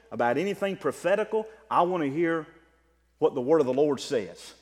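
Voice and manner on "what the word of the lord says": ordinary delivery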